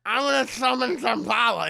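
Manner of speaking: loud rough doll villain growl; mock raspy Chucky. Chucky with half his face gone, loud villain snarl. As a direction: snarl it like a wounded killer doll.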